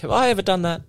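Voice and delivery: high, startled self-question; sudden high mock panic; mock alarm. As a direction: jump up in pitch, suddenly worried. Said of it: "you're just like".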